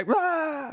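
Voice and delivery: a high, playful monster roar; spring it on them like a jump scare; a hammed-up boo at dinner; mock monster roar. Pie scare at Thanksgiving.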